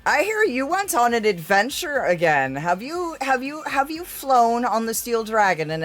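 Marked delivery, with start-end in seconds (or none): none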